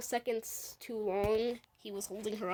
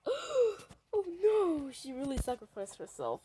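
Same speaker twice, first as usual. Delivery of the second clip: gasping dismay; 7 semitones higher than usual. Retold or imitated. Kid voicing shocked game character.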